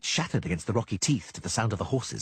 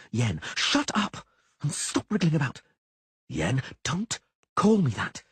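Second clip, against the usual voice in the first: strained argument; 5 semitones higher than usual. A narrator's reading. Strained quarrel on bridge.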